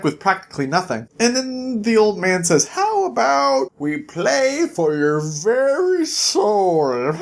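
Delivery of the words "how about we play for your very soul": slow, high, sly devil voice; sinister devil. Retold or imitated. Old man turns devil, bargaining for Jack's soul. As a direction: drag it out like a tempter.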